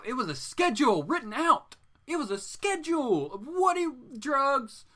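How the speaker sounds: high, loud comic disbelief; incredulous. Real, not acted. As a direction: voice leaps up, aghast at the absurdity. Incredulous about writer's drug schedule.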